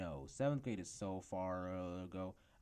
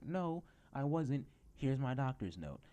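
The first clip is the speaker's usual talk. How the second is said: higher, louder defiant comeback; 6 semitones higher, 5 dB louder than usual. Retold or imitated. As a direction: shut her down with proof. Own retort to teacher.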